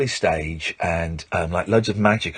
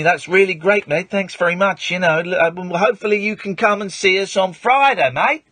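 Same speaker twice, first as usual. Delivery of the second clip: humouring someone; 10 semitones higher than usual. Retold or imitated. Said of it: "he obviously thought I had, like, learning difficulties".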